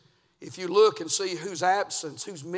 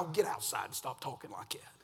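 scolding mom; 4 semitones lower, 7 dB quieter than usual. Retold or imitated. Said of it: "She's like".

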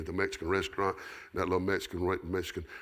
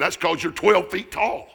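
loud, high, drawn-out punchline; retold comeback; 11 semitones higher, 11 dB louder than usual. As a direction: throw back a big comic retort, slowly. Retelling friend's comeback.

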